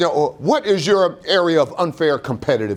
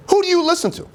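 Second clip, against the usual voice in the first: high emphatic repeat; faster than usual. Real, not acted. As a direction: jump way up in pitch on "Who". Repeats question much higher.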